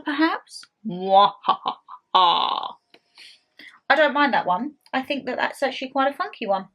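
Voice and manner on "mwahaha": slow mock villain cackle; evil laugh. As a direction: cackle like a mad scientist. Evil laugh at a skull figure.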